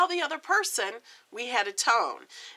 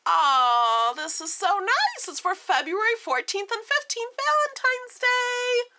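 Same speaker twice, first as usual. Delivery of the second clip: bright gushing rise, much higher; swooning over a sweet Valentine's card; gushing, much higher and delighted; 10 semitones higher, 4 dB louder than usual. Real, not acted. Delighted reading the day's card.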